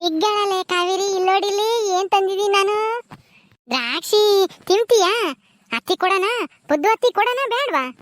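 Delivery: high, drawn-out singsong coaxing; coaxing a child. Acted. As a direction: tempt a little one with a treat.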